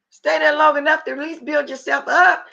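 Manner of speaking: ordinary talk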